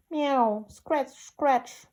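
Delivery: cat meow. A narrator's reading. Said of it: "scuffled Simpkin".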